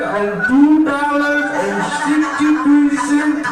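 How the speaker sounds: put-on high, drawn-out Indian-accented demand. Indian landlord demanding money through the door, Indian accent. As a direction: sing out the threat in an Indian accent. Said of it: "I know you heard me do an accent".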